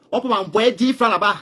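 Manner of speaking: crié fort et lentement, outré; lance-le comme un cri de colère exaspéré; cri indigné. Retold or imitated. Récit indigné d'une demande d'argent. (cri outré et lent contre ceux qui demandent de l'argent)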